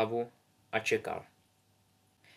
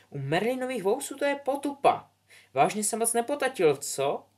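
much higher, other girl's mocking voice; 11 semitones higher than usual. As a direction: sneer at Albus. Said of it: "poznamenala Poličempová".

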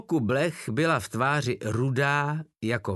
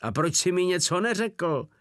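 higher, lightly whiny complaint; whining; 8 semitones higher, 3 dB louder than usual. A narrator's reading. Wolf whining at Porta about the fleas. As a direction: whine it like a wronged child. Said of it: "zafňukal Wolf".

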